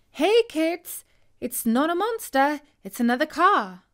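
put-on relieved cheer to the kids; relieved call.

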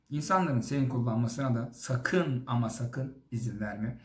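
usual speaking voice

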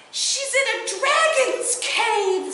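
a breathless son blurting it out to his mother; excited little boy. A narrator's reading.

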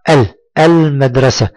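ال alone, then المدرسة with a clear L; pronounced lam. Shown as a demonstration. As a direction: sound the L of ال in المدرسة. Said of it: "انطق معي". (إظهار اللام في المدرسة)

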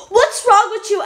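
usual speaking voice

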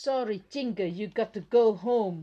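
very slow, loud, each word spelled out; loud and slow, like reading to a toy; slow clear English. Shown as a demonstration. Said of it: "ต้องพูด ภาษาให้ชัดนะคะ".

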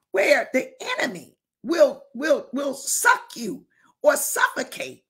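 ordinary delivery

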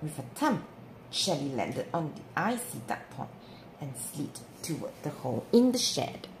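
plain reading voice

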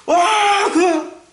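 high, drawn-out gushing with big laughs; Eli. Acted. Eli phoning a golf-loving elder, hammed-up excitement. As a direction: schmooze with over-the-top delight. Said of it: "엘리가 전화를 하는 거야".

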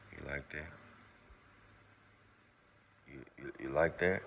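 very soft, low murmur; intimate near-whisper. Shown as a demonstration. Husband talking to wife on the intercom, hushed and low. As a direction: speak low and hushed, with long pauses. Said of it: "whisper on the intercom and say".

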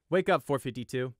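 loud, curt order; gruff guard. Acted. Pegasus guard waking the prisoner: loud, curt. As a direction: bark him awake.

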